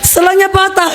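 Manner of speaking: high desperate mock cry to Jesus; screaming for help. Beaten boy crying out to Jesus, high desperate retold scream. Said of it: "Pas saya teriak".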